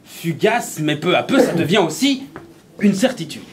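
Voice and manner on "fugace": ordinary delivery, nothing marked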